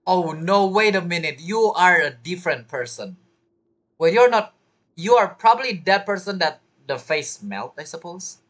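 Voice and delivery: ordinary talk, nothing marked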